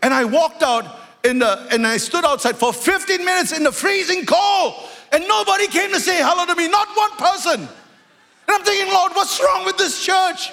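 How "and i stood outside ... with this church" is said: indignant shout. Retold or imitated. Preacher retelling his outrage at being ignored, shouting. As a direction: pour out wounded pride at the top of the voice. Angry retold outburst in church.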